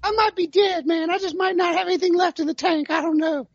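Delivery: a deliberately bad take on a worn-out quarterback with nothing left in the tank; hammed-up high voice of a washed-up passer; Phillip Rivers impression. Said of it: "that was my Tally Phillip Rivers impersonation, which was terrible".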